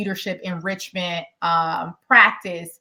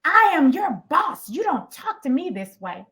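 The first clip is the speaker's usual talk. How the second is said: indignant boss; 6 semitones higher than usual. Retold or imitated. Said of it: "She said".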